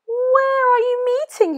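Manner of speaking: high, sly fox asking. Acted. Fox asks the mouse, in a high sly voice.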